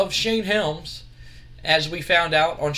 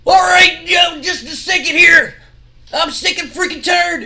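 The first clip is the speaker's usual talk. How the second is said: much higher, much louder hammed-up yell; angry shout; 11 semitones higher, 10 dB louder than usual. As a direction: bellow it like a furious wrestler barging in. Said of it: "you hear somebody go".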